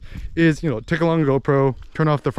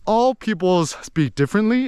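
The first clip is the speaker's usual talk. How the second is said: ordinary talk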